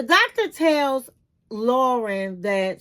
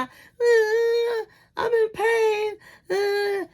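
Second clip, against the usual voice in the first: imitating a wife's crying moan, pitched up high; mock crying; 9 semitones higher, much slower than usual. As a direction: whimper it like a crying wife in pain. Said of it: "all she do really is complain and cry".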